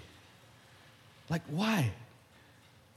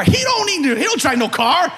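ordinary talk, nothing marked

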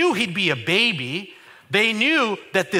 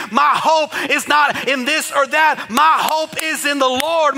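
loud, rising preacher's cry building to a shout; preaching at full force; 6 semitones higher, 5 dB louder than usual. Acted. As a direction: preach it to the rafters, voice climbing. Preaching climax.

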